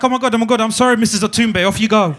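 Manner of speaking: loud, hurried mock apology; flustered apology. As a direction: imitate an official falling over himself to apologise. Border guard apologising in panic.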